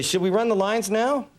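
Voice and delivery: coded prompt, lightly stagey; pretending to run lines. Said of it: "pretend like I was running lines".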